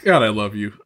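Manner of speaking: ordinary delivery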